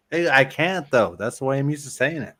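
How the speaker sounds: ordinary talk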